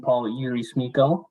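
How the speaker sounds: normal talking voice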